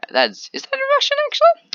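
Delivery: sudden high-pitched double take; surprised. Real, not acted. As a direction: squeak up, caught off guard. Noticing Russian text on screen.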